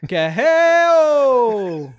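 long, high, very loud whooping holler; powwow call. Pretend. Performing a call on cohost's request, high drawn-out holler. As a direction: let it ring out high and sliding down. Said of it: "he sounds like those ladies at the powwow".

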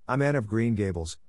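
ordinary delivery

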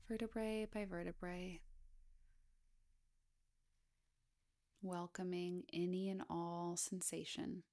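hushed, even body-scan voice with long pauses; guided meditation. Acted. Guide inviting listeners to scan down the body, guided meditation. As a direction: gently lead a meditation, unhurried, leaving space between phrases.

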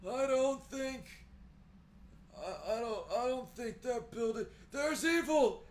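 put-on high, loud, slow old man panic; a frantic old man crying warning. Shown as a demonstration. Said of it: "old man voice the whole time".

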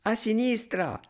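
put-on mild storybook shout in a child voice; little one's cry. A narrator's reading. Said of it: "il piccino gridava". (gridolino acuto da bambino)

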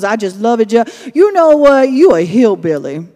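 loud, high mock Cajun granny voice; old Cajun woman. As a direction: ham up an old Cajun lady calling out. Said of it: "she's an old Cajun woman".

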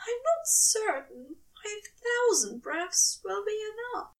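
a fretful angel doubting himself; anxious angel. A narrator's reading. Aziraphale voiced in story.

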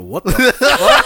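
slow, stunned what the fuck; laughing in disbelief. Retold or imitated. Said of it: "I'm like".

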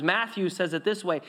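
usual speaking voice

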